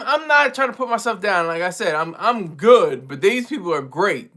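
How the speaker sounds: ordinary delivery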